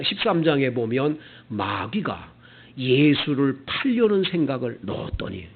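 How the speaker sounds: ordinary talk, nothing marked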